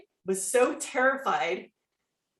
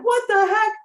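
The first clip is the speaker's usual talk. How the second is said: high, loud what the heck; astonished, can't believe it; sudden high surprised outburst; stunned disbelief; 13 semitones higher, 4 dB louder than usual. Retold or imitated. Disbelief at winning the division.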